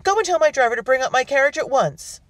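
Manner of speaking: high, loud imperious command; screamed order. A narrator's reading. Guest sends butler for her carriage, loud screamed demand. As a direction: demand it urgently at full voice. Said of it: "she screamed at the butler".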